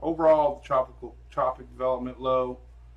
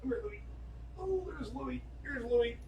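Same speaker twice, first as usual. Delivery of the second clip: high, soft coo to a dog; softly cooing in a high pet voice; pet-coaxing voice; 11 semitones higher, 9 dB quieter than usual. Real, not acted. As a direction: sweet-talk the dog, pitched way up and softly. Coaxing his dog on camera.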